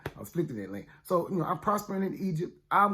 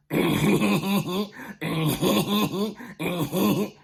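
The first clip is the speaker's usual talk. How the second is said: imitated brother's laugh; 3 semitones higher than usual. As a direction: cackle like a brother heard in the line. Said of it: "That sounds just like my brother's laugh".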